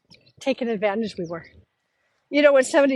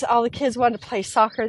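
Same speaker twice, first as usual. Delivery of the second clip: normal talking voice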